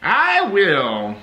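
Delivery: loud, high and emphatic; emphatic vow. Retold or imitated. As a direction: declare it loud and proud. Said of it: "I was emphatic about it".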